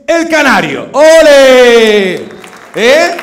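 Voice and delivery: loud, high proud cheer; proud shout for the Canary accent; cheering ole. Acted. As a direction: belt out the ole like a fan at a bullfight. Comic hails the Canary accent.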